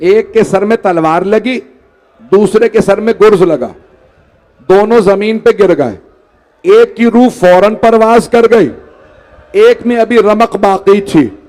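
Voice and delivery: chanted, grieving storyteller's cadence; mourning recitation. Acted. Preacher recites the martyrdom to the gathering, in a mourning chant. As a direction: recite the tragedy to move the mourners. Majlis narration continues.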